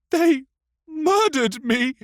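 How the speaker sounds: put-on very high, loud child's outburst; anguished boy. A narrator's reading. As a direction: burst out as a wronged boy. Wronged boy bursts out.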